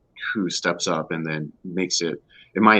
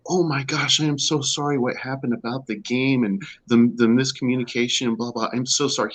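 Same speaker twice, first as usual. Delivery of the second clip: higher-pitched, gushing mock apology; imitated effusive apology; 6 semitones higher, 4 dB louder than usual. Husband retelling organizer's apology to his wife, effusively apologetic. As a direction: lightly act out someone apologizing profusely.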